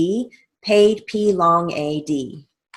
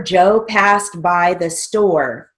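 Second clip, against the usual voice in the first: slow dictation; 3 dB louder, slower than usual. Shown as a demonstration. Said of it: "second sentence over".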